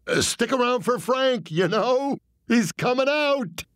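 high, strained, anxious pitch; nervous explaining. Retold or imitated. Comic anxiously explaining his joke to mobsters, high. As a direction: plead the joke upward. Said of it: "I was making fun of myself".

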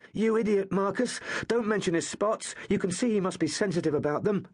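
high, sharp scolding of a friend; exasperated scolding. Acted. Gaius scolds Marcus for insulting the bully, high exasperated. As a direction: rebuke him fast before it's too late.

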